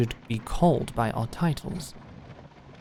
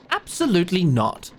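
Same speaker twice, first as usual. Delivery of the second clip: ordinary talk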